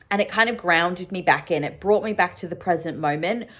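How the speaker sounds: ordinary talk, nothing marked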